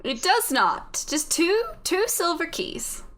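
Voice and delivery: slow, emphatic put-on British; bad British accent. Voice in the head clarifies the errand, slow British accent. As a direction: spell it out slowly in hammy British. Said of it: "My horrible British accent".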